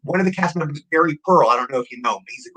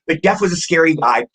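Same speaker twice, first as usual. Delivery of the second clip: usual speaking voice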